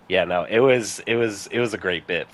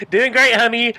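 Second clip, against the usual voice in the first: much higher, louder sing-song praise; mock cheering mom; 14 semitones higher, 6 dB louder than usual. Teasing the actress like a proud mom, high sing-song. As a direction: cheer her on like a doting mom.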